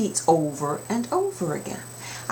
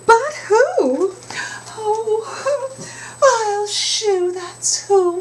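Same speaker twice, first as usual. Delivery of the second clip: high chorus; 14 semitones higher, 4 dB louder, slower than usual. Acted.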